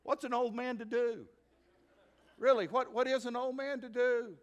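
loud, high, impassioned preaching; preaching at full force. Acted. Preacher dramatizing grandpa's dilemma.

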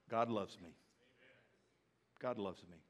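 a quiet personal affirmation; tender hush. Real, not acted. Preacher modeling what the believer should say, hushed.

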